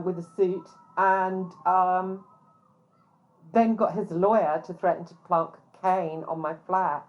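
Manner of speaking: ordinary talk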